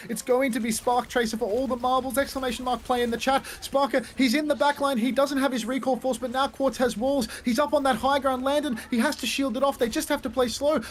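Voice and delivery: high, building play-by-play call; esports caster. Real, not acted. Live play-by-play.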